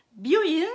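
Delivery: "Byoin" loud and high, short O; "Byoin" as "bi-o-ine", O not lengthened. Shown as a demonstration. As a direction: call out "Byoin" clearly with a brief O. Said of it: "Byoin, ça veut dire, euh, l'hôpital".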